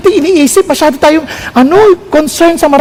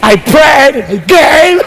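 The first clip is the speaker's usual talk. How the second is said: bragging; 4 semitones lower, much slower than usual. Pretend. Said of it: "hindi to para magpa-impress".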